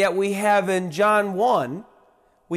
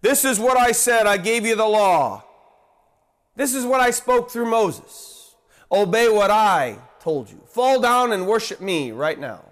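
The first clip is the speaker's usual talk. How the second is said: louder, higher stern commanding voice; imagined God demanding; 5 semitones higher, 4 dB louder than usual. Pretend. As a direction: lay down the law. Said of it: "He could have said".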